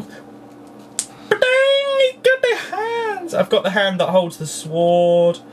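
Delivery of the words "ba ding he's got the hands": high ta-da squeal; excited reveal. Real, not acted. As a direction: present it like a magic trick. Revealing toy hands.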